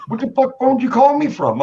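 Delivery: can't believe what he's hearing; mock disbelief on the phone; incredulous. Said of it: "He's like".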